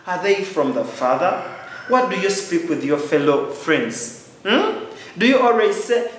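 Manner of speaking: ordinary talk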